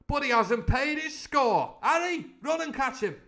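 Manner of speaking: loud, high commanding shout; crying out. Acted. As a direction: order Harry after him at full voice. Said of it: "cried Silver".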